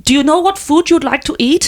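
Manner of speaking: helpful question in a put-on German accent. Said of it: "I'm gonna do the accent".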